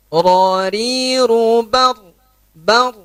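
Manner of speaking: "Ra, ri, ru, bar" dengan dua getaran; two-beat r trill. Shown as a demonstration. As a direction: trill the r just twice. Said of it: "Cukup dua kali getaran saja". ("Bar" dengan getaran dua kali saja)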